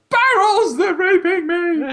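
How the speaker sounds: high, loud, slow hammed-up shriek; mock hysterical wail. YouTuber mocking clickbait outrage, hammed-up high shriek. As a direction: wail it like a fake victim. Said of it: "I don't just go".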